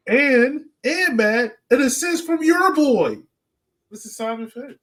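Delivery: high, loud hype for a buddy's assist; excited hype. Real, not acted. Hyping up a teammate's assist.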